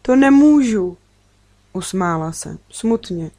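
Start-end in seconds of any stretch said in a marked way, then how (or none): 0.0-1.0 higher, louder alarmed protest; frightened refusal. A narrator's reading. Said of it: "řekla jsem vyděšeně". (vyděšeně, zvýšeným hlasem)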